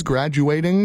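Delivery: ordinary talk, nothing marked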